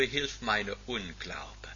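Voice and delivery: slow breathy whisper, barely voiced; hushed plea of a doubter; whispered prayer. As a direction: pray it under your breath, slowly. Quoted prayer of doubting father.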